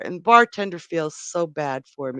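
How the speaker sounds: ordinary talk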